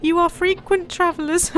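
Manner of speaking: an eager lad asking; boy voice. Acted. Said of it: "as it's a boy".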